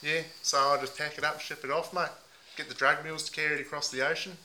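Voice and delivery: a lightly put-on Aussie bloke accent, mate on every line. Host playing a heartbroken meth packer in a mild put-on Aussie accent. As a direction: ham up the ocker Aussie, leaning on mate. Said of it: "put on a few different voices".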